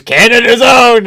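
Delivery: high, loud announcer call; mock announcer. Mocking the Canadian announcer, loud announcer call. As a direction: belt it like a hype announcer. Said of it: "he goes".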